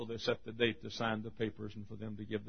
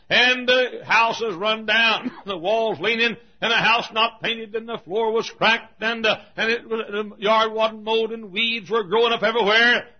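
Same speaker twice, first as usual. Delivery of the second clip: slow, loud, raised-pitch description; preacher's storytelling; 11 semitones higher, 13 dB louder than usual. A narrator's reading. Preacher describing the run-down shack, loud slow build. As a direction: pile up each detail emphatically.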